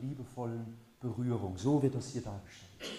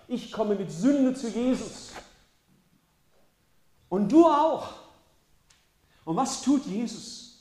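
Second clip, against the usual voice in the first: high and loud, pressing each line home; preaching at full force; 8 semitones higher, 9 dB louder than usual. Acted. Preacher to his congregation about coming with sin, forceful preaching. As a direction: preach it hard to the whole hall.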